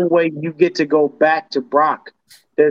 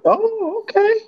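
mock surprise; 13 semitones higher than usual. Mock reaction to surprise pick.

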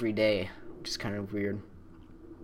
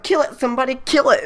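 high, loud mock-frantic command; urgent shrieked order, hammed up; panicked teacher; 11 semitones higher, 8 dB louder than usual. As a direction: imitate a freaked-out teacher begging for help. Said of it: "Somebody, she's like".

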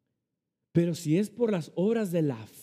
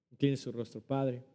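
closing prayer; 2 semitones lower than usual. Acted. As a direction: lead the congregation into prayer gently.

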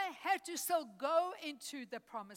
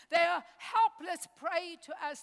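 ordinary talk, nothing marked

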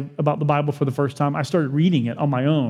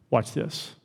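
quiet confident aside; 6 semitones lower, 8 dB quieter than usual. Acted. Jesus answers again.

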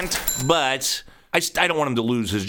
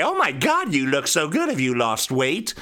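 gushing compliment. Retold or imitated. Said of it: "they'll say".

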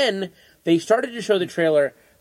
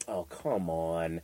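low, soft, slow dismissive groan; cynical film snob; 9 semitones lower, 11 dB quieter, much slower than usual. Retold or imitated. Film snobs watching a trailer, cynical low grumble. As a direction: drag it out in quiet mock disdain. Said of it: "cynical film snobs are sitting there".

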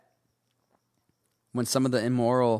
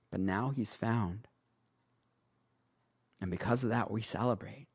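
tender father; 4 dB quieter than usual. Acted. Father gently answers upset son.

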